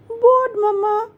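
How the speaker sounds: put-on high-pitched child voice; high little daughter voice; little girl Rini. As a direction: answer mom like a small kid, up high. Child Rini answers mom.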